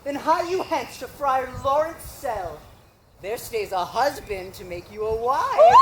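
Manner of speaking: ordinary talk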